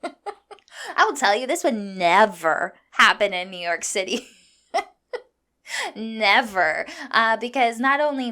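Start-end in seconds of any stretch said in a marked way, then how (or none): 0.0-6.9 fired up, breaking into laughs; laughing indignation. Real, not acted. Telling listeners how New York would differ, laughing indignantly. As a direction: insist on it through real laughter.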